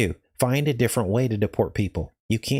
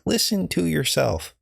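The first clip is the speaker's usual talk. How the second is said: higher, slower, exasperated; exasperated retort; 7 semitones higher than usual. Retold or imitated. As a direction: drag it out in disbelief. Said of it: "I'm like".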